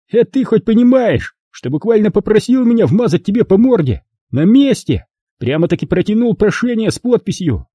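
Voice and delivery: high, menacing schoolyard threat; threatening bully Rob. A narrator's reading. Rob threatening to punch John, a high menacing taunt.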